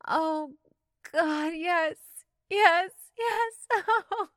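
high, loud cries of yes. Acted. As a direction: cry out in pleasure, pitched high. Pleasure cries.

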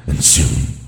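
loud booming demon voice. Acted. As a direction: roar it out like a demon. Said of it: "You wanna hear my demon voice?".

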